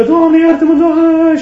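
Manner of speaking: high, loud cry for mercy; desperate plea. Acted. Dying sinner crying to God, loud and desperate. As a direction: cry out to God, begging forgiveness.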